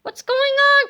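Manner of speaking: high, loud startled question; alarmed cry. A narrator's reading. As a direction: cry out in confusion. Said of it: "cried Harold".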